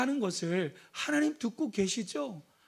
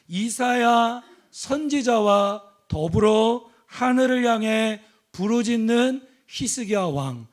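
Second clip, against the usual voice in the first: louder and slower, preaching at full force; rising preaching; 2 semitones higher, 7 dB louder, slower than usual. Real, not acted. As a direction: drive it home to the congregation. Preacher builds to climax.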